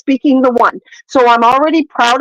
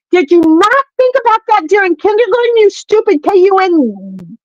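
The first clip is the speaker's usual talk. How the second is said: scornful rant; 6 semitones higher than usual. Real, not acted. Ranting insult at housewife.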